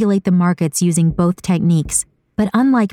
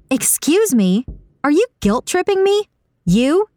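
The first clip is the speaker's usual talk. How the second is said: high-pitched, affronted questions; offended woman's character voice, pitched high; indignant disbelief; 9 semitones higher than usual. A narrator's reading. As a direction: challenge them, voice leaping up in disbelief. Saga, accused, challenges Esper.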